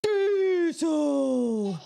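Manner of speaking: put-on high, loud, wailing toddler Diesel. Mother imitating her toddler shouting the dog's name. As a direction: belt it out like a stubborn little kid. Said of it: "Auden would be like".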